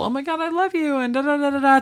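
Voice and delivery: high, gushy mock-sincere; teen friends making up in the yard; gushing friend. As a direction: overdo the sitcom tenderness. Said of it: "it turns into this whole".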